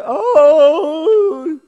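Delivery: a high, very loud pretend wail of panic; mock meltdown wail. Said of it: "go have a meltdown".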